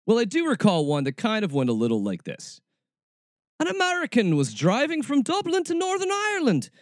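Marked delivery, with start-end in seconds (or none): 3.6-6.7 attempted Irish accent. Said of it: "I'm bad at accents".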